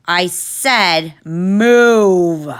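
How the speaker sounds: very slow, loud bellow; yell. Acted. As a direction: bellow like a cow. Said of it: "The worker leaned toward them and yelled".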